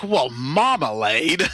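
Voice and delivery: slow, drawn-out exaggerated relish; said just right, suggestively. Shown as a demonstration. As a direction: stretch out marmalade as if it were naughty. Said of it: "If you say it just right".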